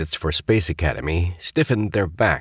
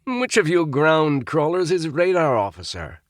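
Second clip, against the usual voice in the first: stern upperclassman; 10 semitones higher than usual. A narrator's reading. Roger hazing cadets.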